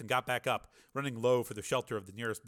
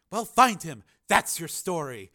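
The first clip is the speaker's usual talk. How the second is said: high, loud bossy command; shouted order; 6 semitones higher, 9 dB louder, slower than usual. A narrator's reading. Reporter sending her rival away, shouted order. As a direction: order a rival off over the noise.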